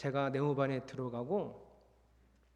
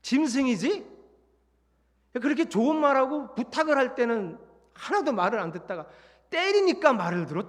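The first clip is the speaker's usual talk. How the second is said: higher, louder furious rant; angry scolding; 7 semitones higher, 8 dB louder than usual. Retold or imitated. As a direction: tear into the troops. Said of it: "걔네들 앞에 서서 이렇게 얘기했어요".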